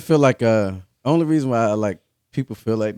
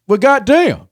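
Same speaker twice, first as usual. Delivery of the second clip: high, loud exclamation of disbelief; mock outrage; 12 semitones higher, 8 dB louder, slower than usual. Men reacting to someone who won't drink, mock outrage. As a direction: blurt it out as an exaggerated reaction. Acting out men's reaction to refusal.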